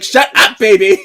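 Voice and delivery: loud, high comic scolding; mock exasperated yell. Reader scolding noisy baby Parker, mock exasperated yell.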